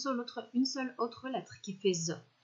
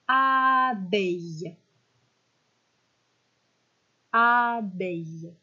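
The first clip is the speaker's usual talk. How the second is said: "abeille" drawn out slowly and louder; slow dictation; 6 dB louder, much slower than usual. Shown as a demonstration. Teacher dictating a word for pupils to write, slow and loud. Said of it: "Vous mettez pause et vous l'écrivez".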